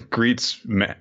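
normal talking voice

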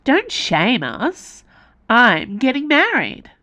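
high, slow mock-offended protest; tipsy lady. A narrator's reading. Perea protesting to Icaros about her wedding, high and slow. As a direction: pretend to be hurt, drawn out.

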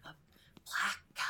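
breathy hushed whisper. Real, not acted. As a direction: whisper it like a secret. Whispered racial aside.

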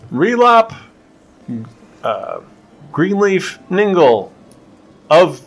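high, loud drawn-out herald call; herald announcement. Retold or imitated. Herald announces Relop to the noble party, booming. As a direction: proclaim each name to the hall.

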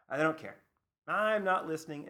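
stubborn refusal. Retold or imitated. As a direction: imitate a customer shutting the talk down. Retold customers refusing to listen.

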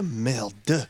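drawn-out mock French accent; dismissive French instructor. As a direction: swear in hammy French. Playing the French canoe man.

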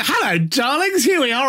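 high, gushing on-air hello; showy radio greeting. Retold or imitated. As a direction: sing out a flamboyant greeting to listeners. Re-enacting his on-air greeting.

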